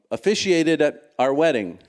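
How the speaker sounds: usual speaking voice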